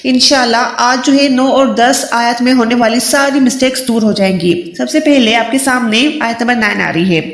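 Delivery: ordinary talk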